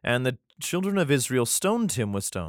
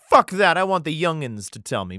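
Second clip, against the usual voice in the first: louder, higher dismissive outburst; hammed-up impatient young ruler; mock defiant king; 5 semitones higher, 5 dB louder than usual. King brushing off the old guys for the youngins, mock defiant. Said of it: "But he's like".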